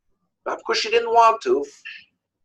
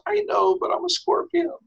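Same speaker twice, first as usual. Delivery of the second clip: high, softer storybook confession; helpless admission; 11 semitones higher, 4 dB quieter than usual. Acted. Scorpion answering the stung frog, high helpless admission. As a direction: own up helplessly, high and small.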